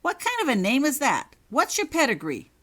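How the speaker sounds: high, loud scornful demand; scornful insistence. Acted. Prefect mocking Holly's name, loud scornful demand. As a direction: sneer and demand at once. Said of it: "Richards insisted".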